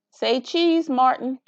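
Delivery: high, bright call to the clouds; playful photo call. Acted. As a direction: coax them to smile like a child with a camera. Child photographing.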